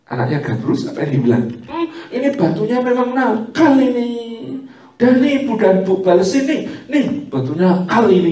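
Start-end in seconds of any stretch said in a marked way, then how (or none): 1.7-8.3 higher, laughing mock coddling; lightly voiced doting mother; soothing mother blaming a stone. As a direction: coo it like a mother comforting a toddler.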